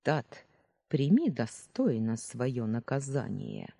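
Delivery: plain reading voice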